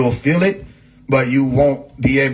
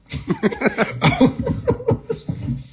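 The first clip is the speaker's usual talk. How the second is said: long high wail dissolving into laughter; a laughing falsetto howl; high laughing shriek; 4 dB quieter than usual. Retold or imitated. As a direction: let out a high rising mock cry. Imitating spiritual shriek.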